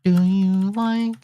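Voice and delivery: very high, very slow line; put-on character voice. Shown as a demonstration. Another try at his recording line, very high and slow. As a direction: drag the question out high and slow. Final rehearsal take.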